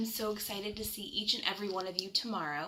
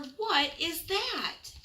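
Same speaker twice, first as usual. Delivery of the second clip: put-on high, very slow, wondering child's voice; a small boy asking in wonder; curious little raccoon; 9 semitones higher, much slower than usual. Chester asks curiously.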